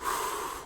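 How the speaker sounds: heavy exhale before speaking; acted preparatory sigh; deep breath. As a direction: let out a long, bracing breath. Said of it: "kinda takes a deep breath".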